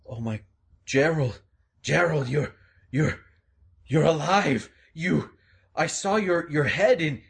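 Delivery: stunned Taku. Acted. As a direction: gasp it out in disbelief. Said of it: "Taku comes, comes close".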